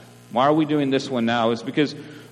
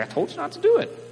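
much higher mock voice of God; God's reply; faster than usual. Pastor voicing God answering the complaining believer, lightly mocking. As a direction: toss it back lightly, a gentle told-you-so. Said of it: "He's like".

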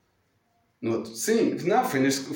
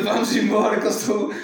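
higher, louder, hurried; urgent call; 8 semitones higher, 5 dB louder than usual. Retold or imitated. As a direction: pull the dog away, raised voice. Ele próprio aflito a puxar. ("vamos embora" aflito e mais alto)

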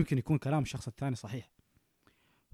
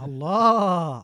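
impressed drawn-out exclamation; 2 semitones higher, 7 dB louder, much slower than usual. Real, not acted. Impressed by host's answer.

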